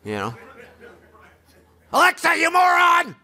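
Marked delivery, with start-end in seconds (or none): 1.9-3.2 hammed-up furious outburst; mock angry yell. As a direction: berate Alexa at full shout. Imitating someone yelling at Alexa.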